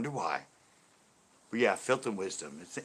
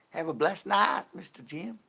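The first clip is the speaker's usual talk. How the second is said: higher, louder Texas twang; Texas accent; 8 semitones higher, 6 dB louder than usual. Shown as a demonstration. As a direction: send the farewell out in a raised Texas voice. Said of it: "I'll say that in a Texas accent".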